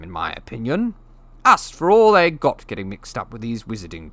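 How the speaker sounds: pompous, contemptuous rant; sneering bully in full flow; Uncle Vernon's voice. Acted. Vernon rants about Harry's parents.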